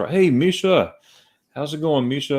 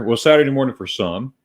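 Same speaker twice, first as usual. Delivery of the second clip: ordinary delivery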